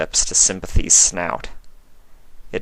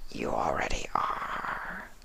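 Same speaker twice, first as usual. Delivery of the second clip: slow, breathy creature whisper; whispering changeling; 5 semitones lower, 13 dB quieter, slower than usual. A narrator's reading. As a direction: hiss it out like a hungry changeling. Changeling speaks to Fluttershy.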